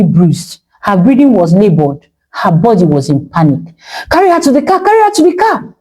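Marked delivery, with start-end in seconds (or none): 4.1-5.7 high, urgent shouted order. A narrator's reading. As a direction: get everyone moving now. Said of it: "someone shouted".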